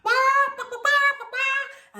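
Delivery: high, loud clucking alarm call; urgent hen alarm cry; hen calling chicks. A narrator's reading. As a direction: squawk to gather the chicks under her wings. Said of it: "called out to her chicks".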